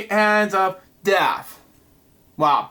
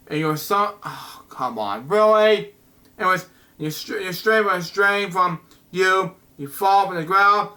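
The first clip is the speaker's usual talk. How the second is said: usual speaking voice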